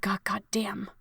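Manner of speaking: an unvoiced, under-the-breath curse; a whispered God damn. Frustrated under her breath.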